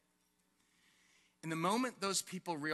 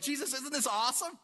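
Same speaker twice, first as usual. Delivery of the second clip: higher, eager question; excited disciple; 6 semitones higher than usual. Acted. Preacher plays disciple jogging up to Jesus, excited. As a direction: share the thrill, pitched up.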